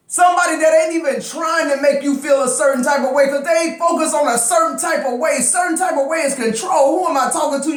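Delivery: fired-up yelling. Real, not acted. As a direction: shout it so they can finally hear. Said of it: "Scorpio know why I'm yelling".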